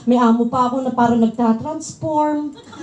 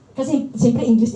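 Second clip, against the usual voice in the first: normal talking voice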